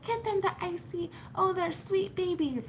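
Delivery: high, cooing lady voice; mocking high-voiced busybody; imitating a meddling stranger. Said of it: "She was like".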